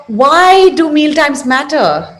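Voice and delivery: ordinary talk, nothing marked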